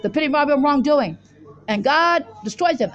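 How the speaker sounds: ordinary delivery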